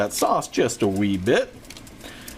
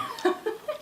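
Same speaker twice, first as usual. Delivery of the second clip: airy high laugh; breathy laugh; 7 dB quieter than usual. Real, not acted. Laughs at the joke.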